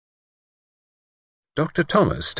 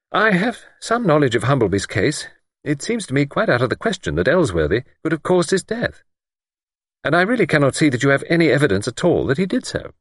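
higher, measured, a hint of a superior doctor; lightly voiced doctor; 8 semitones higher than usual. A narrator's reading. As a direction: play the calm, sceptical doctor lightly. Narrator voices Doctor Thomas.

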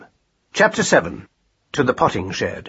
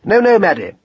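high, louder protest; correcting husband; 7 semitones higher, 5 dB louder than usual. A narrator's reading. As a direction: jump in to put her right.

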